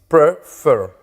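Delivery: "Prefer" broken in two, pre-FER; stress on the final syllable. Shown as a demonstration. Teacher counting two syllables for students, final-syllable stress. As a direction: split "Prefer" and lean on FER. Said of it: "La mayor fuerza de voz".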